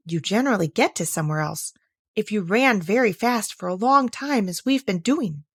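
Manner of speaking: ordinary delivery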